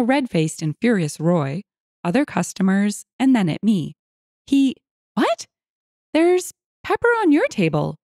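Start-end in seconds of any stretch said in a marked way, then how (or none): 4.5-8.0 high-pitched incredulous question; baffled owner. A narrator's reading. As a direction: puzzle it out in disbelief. Baffled owner answers Roy.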